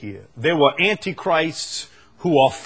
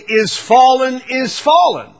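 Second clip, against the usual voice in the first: proclaimed cry; 8 semitones higher, 7 dB louder than usual. Retold or imitated. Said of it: "you could say".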